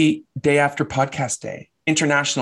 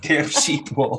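gruff Scottish shepherd; 3 dB quieter, slower than usual. Pretend. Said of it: "You're really getting into character".

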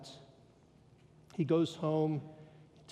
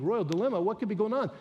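higher, louder curious listener; mock wondering; 5 semitones higher, 5 dB louder, much faster than usual. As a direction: voice the puzzled listener's question. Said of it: "You think".

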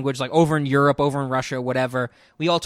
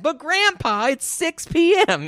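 put-on high, slow whiny kid voice; whiny grandkid; 13 semitones higher than usual. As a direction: drag it out like a pleading grandchild. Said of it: "George Carlin joke".

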